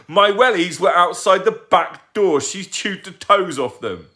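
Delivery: angry rant. Acted. Tugg ranting.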